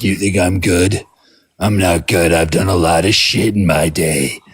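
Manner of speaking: a hint of a world-weary tough guy; grizzled tough guy. Jesus as a grizzled ex-con brushing off praise, lightly roughened. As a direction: play it like a man who's seen too much. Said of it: "someone who's had a bit of a rough life".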